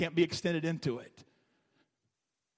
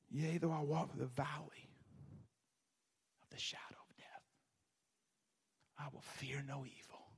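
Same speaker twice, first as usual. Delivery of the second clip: soft Psalm read fading into a whisper; hushed scripture reading; 3 semitones lower, 10 dB quieter than usual. Shown as a demonstration. Preacher reciting Psalm 23 near funerals, hushed to a whisper. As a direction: breathe the verse almost silently.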